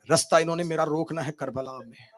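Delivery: ordinary talk, nothing marked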